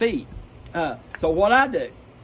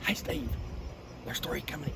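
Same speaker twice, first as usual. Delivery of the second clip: soft, urgent "there's three coming"; quiet hunter's warning; hushed alert; 10 dB quieter than usual. Retold or imitated. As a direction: tip off your buddy without spooking them. Spotting ducks, warning partner.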